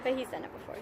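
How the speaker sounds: ordinary talk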